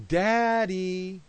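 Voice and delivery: put-on mother coaxing her baby to say daddy, sweetly; wife teaching daddy. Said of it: "My wife would say again and again".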